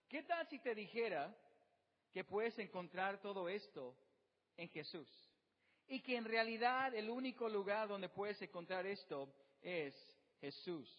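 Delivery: ordinary talk, nothing marked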